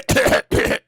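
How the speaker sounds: repeated mock hacking cough; imitated coughing. Imitating people coming to work, mock coughing fit. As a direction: cough as if acting out a sick person.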